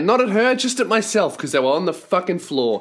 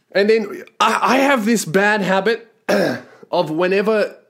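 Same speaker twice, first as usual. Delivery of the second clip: usual speaking voice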